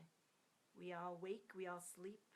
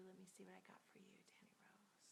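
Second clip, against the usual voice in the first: whispering; 21 dB quieter, much faster than usual. Real, not acted. Tuning in to a viewer.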